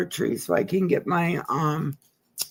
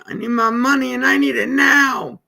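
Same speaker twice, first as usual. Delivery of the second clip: rising into a shout, louder and higher; playful demand; 7 semitones higher, 6 dB louder than usual. Real, not acted. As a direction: shout it out like you mean it. Demanding money from the state.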